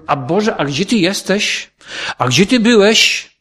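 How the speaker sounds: imitating a person begging God in distress; desperate plea. Preacher voicing believers in trouble, mock desperate plea.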